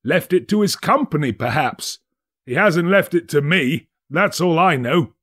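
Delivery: a dry joke for the group; lightly voiced smug businessman; fat merchant, joking.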